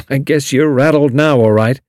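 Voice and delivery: amused teasing drawl; enjoying drawl. A narrator's reading. Julius teasing Tommy, amused drawl. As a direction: savor teasing him. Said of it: "he drawled with some enjoyment".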